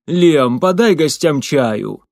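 loud, slow call to a servant. A narrator's reading. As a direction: call across the room. Baron calls the servant.

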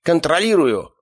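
high, strained call; flustered high-pitched exclamation; crying out. A narrator's reading. Henchman cries out, holding dog.

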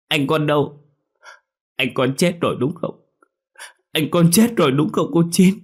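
high, rising tearful wail; a boy breaking into sobs as he asks; wailing child. A narrator's reading. Boy begs aunt if brother died, wailing. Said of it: "Quang lại khóc ré lên".

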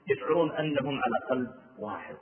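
ordinary talk

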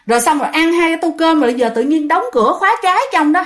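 ordinary delivery, nothing marked

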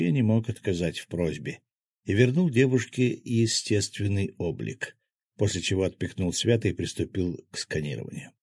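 plain reading voice

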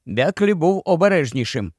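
ordinary delivery, nothing marked

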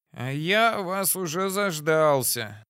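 put-on slow lisping old man. A narrator's reading. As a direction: lisp through every s, unhurried. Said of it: "шепелявя произнёс".

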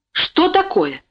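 high, startled mother's question; frightened. A narrator's reading. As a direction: ask in sudden alarm. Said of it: "испуганно оглянулась Александра Сергеевна". (испуганный вопрос)

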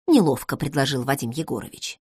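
ordinary delivery

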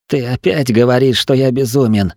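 usual speaking voice

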